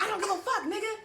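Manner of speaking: high, heated retort; scornful mock yell at the boy in the car; going hard. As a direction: snap back at him hard. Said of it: "I'm going hard".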